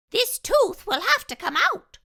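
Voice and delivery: very high, loud squeaky mouse voice; a tiny polite mouse dentist; little mouse speaking up. A narrator's reading. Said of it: "Doctor DeSoto announced".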